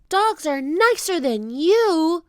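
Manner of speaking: high, loud, drawn-out taunt; indignant high-pitched comeback; defiant retort. A narrator's reading. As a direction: talk back high and slow to a bully. Annie talks back to pirate.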